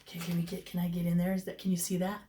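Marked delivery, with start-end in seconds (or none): none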